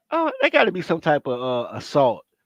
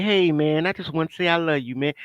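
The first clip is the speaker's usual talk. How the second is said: soft sincere quote; 3 semitones lower, 6 dB quieter than usual. Retold or imitated. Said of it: "I just wanted to be like".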